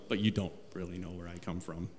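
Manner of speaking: preacher voicing Jesus, turning the point with a low hush; hushed undertone. Shown as a demonstration. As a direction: lean in and say the secret quietly. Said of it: "Yeah, you know where I come from".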